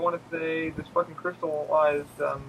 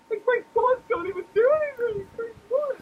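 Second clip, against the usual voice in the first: high, strained, near-crying complaint; mock desperate gamer whimper; panicked whine; 4 dB louder than usual. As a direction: whine it like you're losing badly. Retold panic while losing to bosses.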